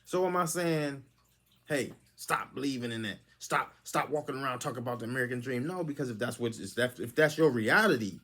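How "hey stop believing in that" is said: ordinary talk, nothing marked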